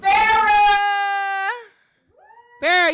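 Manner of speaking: calling out. Real, not acted. Host calls next poet up.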